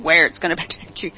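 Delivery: fast mocking reversal with a laugh; mock quote. Mocking Fauci's flip-flop.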